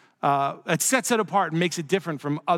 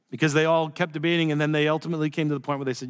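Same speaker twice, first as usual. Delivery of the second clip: ordinary delivery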